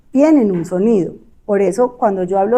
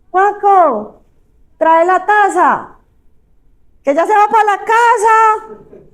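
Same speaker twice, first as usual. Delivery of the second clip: calling husband; 8 semitones higher, 3 dB louder, slower than usual. Acted. Acted mishearing couple dialogue.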